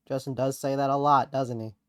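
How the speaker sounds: ordinary talk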